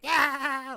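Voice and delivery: very high, loud screech; imitated attack yell. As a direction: shriek like a tiny fighter lunging. Imitating Hasbulla attacking Mickey Mouse.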